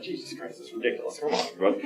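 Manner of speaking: very soft, near-whispered protest; hushed exasperation. Acted. Muttering about a solemn Mass ceremony, hushed exasperation. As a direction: mutter it under your breath, exasperated.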